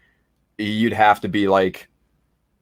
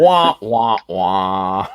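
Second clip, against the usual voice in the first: mock sad trombone; much slower than usual. Teasing a friend about watching Netflix, mock sad trombone. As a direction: imitate a sad trombone, falling away on the last wah.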